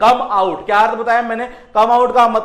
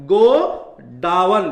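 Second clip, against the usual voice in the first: GODAWAN drawn out very slowly, split as "गो... down"; slow syllable-by-syllable demo; 2 semitones lower, much slower than usual. Shown as a demonstration. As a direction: stretch godown into two long, separate parts. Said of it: "देखना यहां से क्या है ये".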